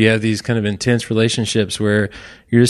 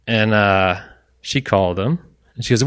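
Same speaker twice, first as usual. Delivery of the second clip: usual speaking voice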